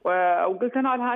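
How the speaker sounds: ordinary talk, nothing marked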